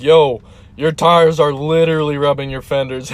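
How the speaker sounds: loud call to a driver pulling away. Retold or imitated. Workers calling after a driver leaving, loud. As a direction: shout it so he hears over the engine.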